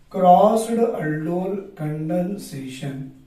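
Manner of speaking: slow, deliberate teacher dictation. Shown as a demonstration. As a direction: spell it out slowly for notes. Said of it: "teacher dictating the name".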